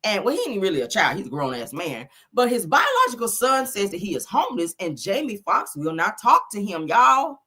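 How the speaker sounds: normal talking voice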